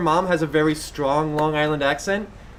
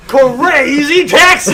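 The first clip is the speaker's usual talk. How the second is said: slow, drawn-out shout, much higher and louder; game title shout; 9 semitones higher, 10 dB louder, much slower than usual. Acted. Cohost booming a video game name at the reckless driver. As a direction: bellow it like a game announcer. Joking about reckless driving.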